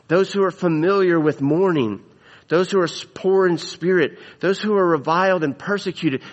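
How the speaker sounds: ordinary talk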